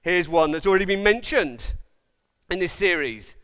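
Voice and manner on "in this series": normal talking voice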